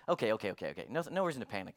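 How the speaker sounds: low, quiet, quick okays; calm reassurance. Retold or imitated. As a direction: keep everyone calm, voice dropped low and soft. Reacting calmly to alarming news.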